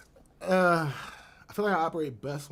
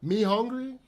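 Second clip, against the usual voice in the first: imitating a beggar; 6 semitones higher than usual. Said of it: "those same speech patterns that she uses when she be out begging".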